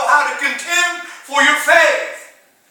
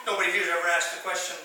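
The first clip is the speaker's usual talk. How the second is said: usual speaking voice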